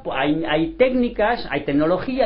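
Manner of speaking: usual speaking voice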